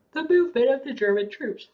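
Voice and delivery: words stretched through a real yawn. Real, not acted. Teacher yawns mid-lecture explaining Allied spy planes, words pulled through a yawn. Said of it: "didn't mean to yawn there".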